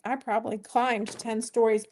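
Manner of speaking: ordinary talk, nothing marked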